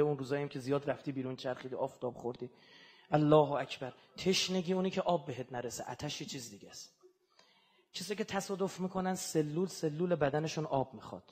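low, soft lament of thirst; hushed mourning sermon. Acted. Preacher evoking thirst for fasting mourners, softly lamenting. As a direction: draw listeners into grief gently. Quiet mournful rowzeh passage.

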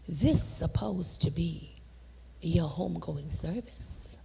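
hushed divine voice. Retold or imitated. God telling her of her own funeral, hushed whisper. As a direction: reveal it in a hush.

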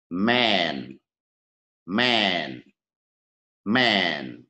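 "Man" with a long, wide-lipped vowel, falling. Shown as a demonstration. Teacher has learners repeat after them, long wide vowel. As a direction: stretch the open vowel in "Man". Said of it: "Ta đọc âm đầu tiên".

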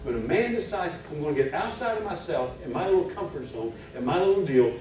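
high, loud, driving sermon delivery; fervent preaching. Acted. Preacher pressing the congregation about faith, fervent. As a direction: preach it hard, rising to a peak. Preaching at full force again.

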